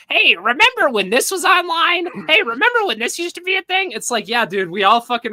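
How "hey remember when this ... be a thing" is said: high, nasal-free mock nostalgic Vito voice, pitched well up; a teasing impression of a wistful podcaster. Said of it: "him going".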